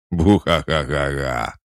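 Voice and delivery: loud mock villain laugh; evil demon cackle. A narrator's reading. Demon taunts Alisa after the blow, hammed-up evil cackle.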